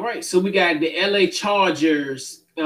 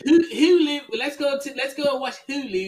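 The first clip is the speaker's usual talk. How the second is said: Hulu said higher, like Baltimore folks do; mock Baltimore accent; 7 semitones higher than usual. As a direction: tease the Baltimore way of saying Hulu. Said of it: "That's how they be in Baltimore".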